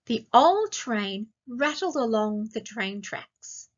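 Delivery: slow, clear reading; slow storybook reading; slowly read. Shown as a demonstration. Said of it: "I'll read each sentence slowly".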